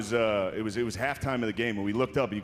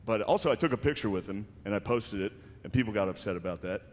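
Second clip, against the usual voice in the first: normal talking voice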